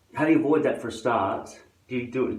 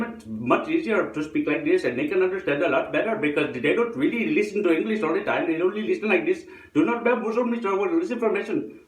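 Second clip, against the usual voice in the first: high, sing-song fake Indian accent; hammed-up Indian accent, pitch jumping up; mock Indian accent; 9 semitones higher than usual. Shown as a demonstration. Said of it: "pretend to speak with an Indian accent".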